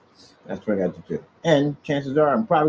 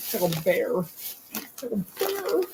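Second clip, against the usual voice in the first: high squeaky pet voice; 9 semitones higher, 4 dB quieter than usual. Real, not acted. Man cooing his cat's name in a high pet voice. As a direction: coo the name up high at the cat. Talking to his cat in baby voice.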